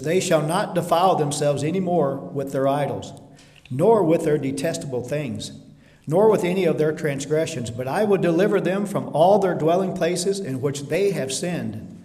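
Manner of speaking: loud, ringing preaching of the verse; forceful scripture reading. Acted. Preacher booming God's promise to the church. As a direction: proclaim it to the congregation.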